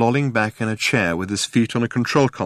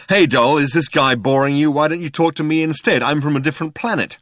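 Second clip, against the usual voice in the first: louder, pitched-up mimicry of a pick-up line; brash chat-up; 6 semitones higher, 3 dB louder than usual. Arthur mimics Zaphod stealing the girl, brash. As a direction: imitate a pushy charmer barging in.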